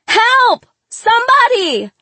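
high, loud desperate scream; panicked full-voiced cry for help. A narrator's reading. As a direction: yell for anyone to save you. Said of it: "I cried".

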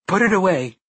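high alarmed cry. A narrator's reading. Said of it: "Mia cried, alarmed".

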